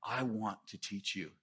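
quiet reassurance; gentle Jesus. Retold or imitated. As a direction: soothe a worried rule keeper. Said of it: "And Jesus is like".

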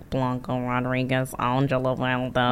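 slow, low, soft mocking of a fake New York accent. Podcaster mocking actors' fake New York accent, slow and low. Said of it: "We hate Blanca's accent so much".

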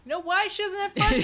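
laughing. Real, not acted. Laughing exasperated rant.

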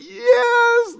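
high, teary mock sob on one word; hammed-up movie-crying gasp; mock weepy wife. Wife in the theater tearing up, mock weepy and high. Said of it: "she's rubbing her eyes".

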